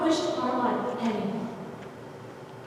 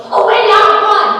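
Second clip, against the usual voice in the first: urgent command, much higher and louder; screamed; 9 semitones higher, 16 dB louder than usual. A narrator's reading. Narrator to her little sister, urgent scream. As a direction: scream at your sister to run. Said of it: "I screamed to Melissa".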